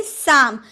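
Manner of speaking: साम starting on s; silent p. Shown as a demonstration. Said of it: "पी का उच्चारण नहीं करेंगे". (साम, प मौन)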